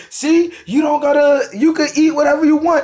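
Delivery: lightly mimicked objector, pitched well above own voice.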